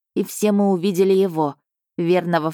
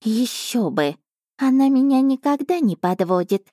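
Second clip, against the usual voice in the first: higher proud cat voice; 6 semitones higher than usual. A narrator's reading. Cat Asha boasts to the emperor, higher and proud. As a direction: boast with your nose in the air. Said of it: "гордоливо заявила кошка".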